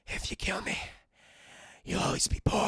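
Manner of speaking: breathy dying whisper; weak whisper. Retold or imitated. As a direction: rasp out the threat with no strength left. Dying Blondie threatening Tuco.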